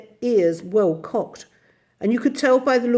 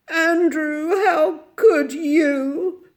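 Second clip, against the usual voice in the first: high, long drawn-out moan; slow, wailing lament; 9 semitones higher, 5 dB louder, much slower than usual. A narrator's reading. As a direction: moan in tearful reproach. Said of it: "moaned Miss Lark".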